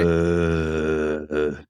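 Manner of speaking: flat, drawn-out zombie groan. Acted. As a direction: groan it like the undead. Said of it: "that's my best zombie impression".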